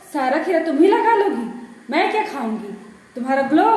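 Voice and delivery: loud mocking complaint; sarcastic jab. Mother mocking daughter's cucumber face mask, sarcastic.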